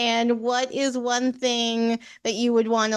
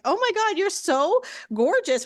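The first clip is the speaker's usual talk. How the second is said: gushing admiration; 7 semitones higher than usual. Retold or imitated. Host retelling her reaction to the guest's look, gushing. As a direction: gush over how good they look.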